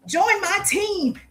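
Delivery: high, slow, drawn-out "Join my team." mocking a pushy recruiter; mocking pushy pitch. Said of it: "I don't need to say".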